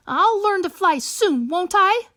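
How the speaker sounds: a chick begging for reassurance; hopeful young bird. Acted.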